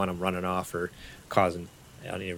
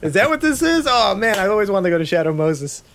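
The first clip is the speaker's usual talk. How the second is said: much higher, louder excited fan; 13 semitones higher, 9 dB louder than usual. Real, not acted. Player thrilled by Metal Gear reference, excited. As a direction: gush with delight.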